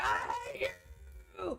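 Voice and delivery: high, breathy mock hatred; Doom hissing hate at his old friend; mock Doom outburst. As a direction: hiss it out hammed up. Voicing Doom's hatred.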